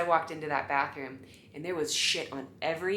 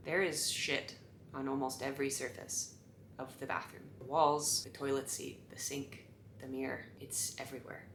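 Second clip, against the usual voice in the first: hushed confession; 4 semitones lower, 10 dB quieter than usual. Retold or imitated. Breaking bad news to attendant.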